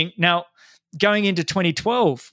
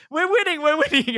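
much higher, thrilled chant; excited yelling; 13 semitones higher than usual. Retold or imitated. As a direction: crow it out while racing. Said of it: "here you were yelling at me".

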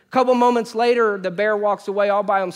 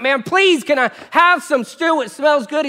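higher, louder hungry pleading; begging brother; 8 semitones higher, 6 dB louder than usual. Acted. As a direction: whine for the stew like a starving sibling. Esau begging brother for stew.

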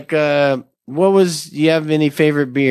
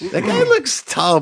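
high pitched; 10 semitones higher than usual. Acted. Joke about tall and short.

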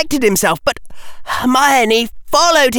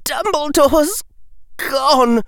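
slow, high, a touch of crying out; cried; 5 semitones higher, slower than usual. Acted. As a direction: cry it out in disbelief. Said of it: "cried Harry".

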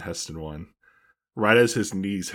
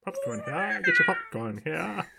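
high strained vendor call; stadium vendor hawking. Pretend. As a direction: hawk popcorn in a squeaky high voice. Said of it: "it sounds like a cat is dying".

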